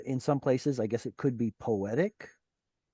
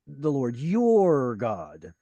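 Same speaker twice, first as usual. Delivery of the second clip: "your" drawn out long and louder; stress on YOUR; 5 dB louder, much slower than usual. Acted. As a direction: lean hard and slowly on the word "your". Quoting someone who pointedly says your.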